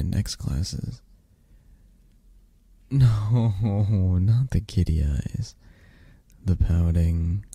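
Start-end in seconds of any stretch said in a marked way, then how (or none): 3.6-4.1 short laugh. Real, not acted. Reacting to kitty eyes.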